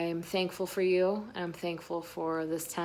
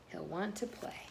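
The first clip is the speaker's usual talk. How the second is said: hushed; 11 dB quieter than usual. A narrator's reading. Storyteller hushed line.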